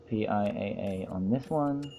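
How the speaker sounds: drawn-out condescending lilt; patronizing. Acted. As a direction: talk down to them, stretching each word. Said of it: "my most patronizing voice".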